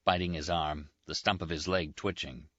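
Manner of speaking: usual speaking voice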